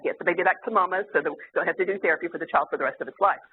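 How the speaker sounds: fast, urgent researcher line; hurried researcher. Retold or imitated. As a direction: rush it out like a worried researcher. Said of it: "the researcher's like".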